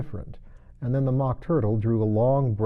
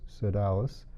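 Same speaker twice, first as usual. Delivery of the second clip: usual speaking voice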